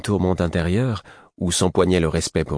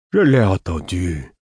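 slow, drawn-out weary reply; tired; 4 dB louder than usual. A narrator's reading. Sleepy Lourd answering that he heard, tired. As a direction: drag the words out tiredly. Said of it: "d'un air fatigué".

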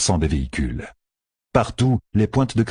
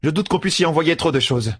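higher-pitched, mildly grumbling sister; 10 semitones higher than usual. A narrator's reading. Sister complaining to Fail about being kept back, grumbling. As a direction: grumble in a raised character voice. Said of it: "ronchonna la sœur".

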